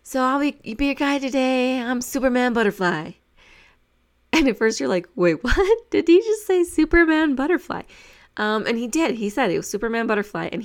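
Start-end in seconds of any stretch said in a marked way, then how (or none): 0.0-3.1 a lightly voiced upbeat guide, a bit higher; excited guide intro. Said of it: "He was super excited". 5.2-7.8 disbelief. Retold or imitated. Disbelief at guide's name.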